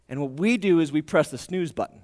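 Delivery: ordinary talk, nothing marked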